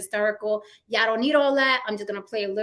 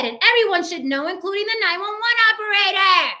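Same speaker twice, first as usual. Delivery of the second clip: indignant; 8 semitones higher, 6 dB louder than usual. Retold or imitated. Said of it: "as she said".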